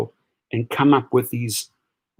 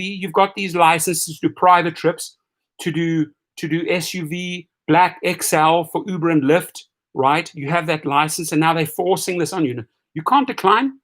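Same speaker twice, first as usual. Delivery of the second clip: ordinary delivery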